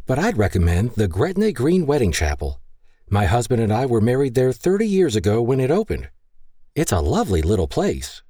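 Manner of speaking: a hint of a chatty older passenger; older lady. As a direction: chime in politely with a fond memory. Older lady recommends chapel.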